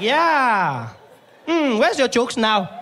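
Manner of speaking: high, loud, slow taunting; gloating nurse. Retold or imitated. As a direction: savour his bad luck.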